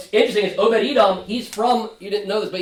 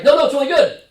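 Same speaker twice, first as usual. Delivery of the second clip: higher and louder, over-eager; mock eager reassurance; 9 semitones higher, 8 dB louder, faster than usual. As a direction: gush like it was always fine. David's eager change of heart.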